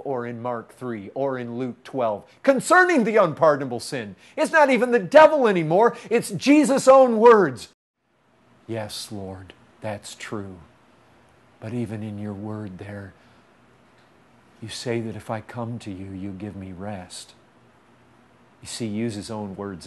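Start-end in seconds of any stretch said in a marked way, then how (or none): 2.4-7.7 loud, high preaching shout; impassioned preaching. Real, not acted. Preacher at climax of argument. 8.7-17.3 soft, low, humble prayer; hushed, unhurried appeal to the Lord; humble submission. Acted. Humble answer to the Lord, after shouting.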